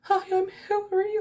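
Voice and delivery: put-on high, eager child's greeting; little kid voice. Nine-year-old meeting [name], high and starstruck. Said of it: "I was like".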